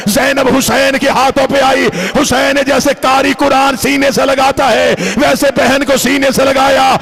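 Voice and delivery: building lament, delivered at full voice; impassioned majlis preaching. Acted. Preacher narrating the baby's eyes to mourners, impassioned and building. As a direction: drive the mourning narrative toward a wail.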